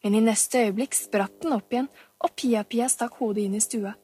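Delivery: ordinary delivery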